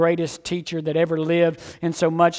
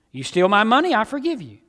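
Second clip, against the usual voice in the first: ordinary talk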